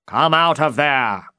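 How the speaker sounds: loud, high challenge; shouted challenge. Acted. Knight shouts at the lion to come out. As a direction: call the lion out loudly. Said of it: "shouted Don Quixote".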